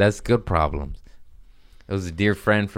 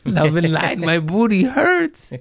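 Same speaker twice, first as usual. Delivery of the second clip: rising, higher, airy hippie chant; hammed-up spiritual voice after the ayahuasca; mock enlightened voice; 9 semitones higher, 3 dB louder than usual. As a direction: sing it like a blissed-out guru. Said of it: "I'm like".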